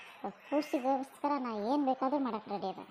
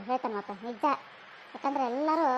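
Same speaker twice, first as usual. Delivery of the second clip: usual speaking voice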